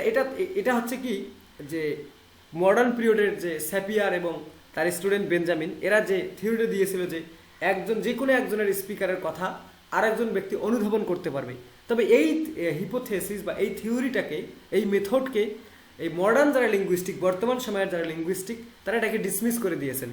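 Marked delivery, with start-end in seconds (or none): none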